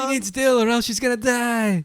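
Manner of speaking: high-pitched hammed-up alarm; mock emergency plea. Co-host plays responder at the crash, mock desperate plea. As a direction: plead as if a life hangs on it.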